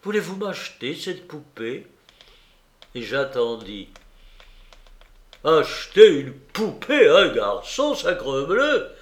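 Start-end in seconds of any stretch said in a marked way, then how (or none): 0.0-1.9 put-on small, higher, softer child's request; hesitant boy pleading softly; with effort. A narrator's reading. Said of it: "dis-je avec effort". 5.4-8.9 loud, indignant uncle's outburst; booming exclamation of outrage; thundering voice. A narrator's reading. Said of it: "s'écria mon oncle du doigt de tonnerre".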